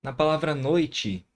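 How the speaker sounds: usual speaking voice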